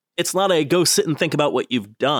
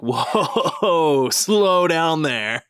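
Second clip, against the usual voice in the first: very slow, laughing, a touch higher; voicing his own body; 5 semitones higher, much slower than usual. Speaker voices his body reacting to burgers, slow and laughing. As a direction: drawl it out like your body pumping the brakes.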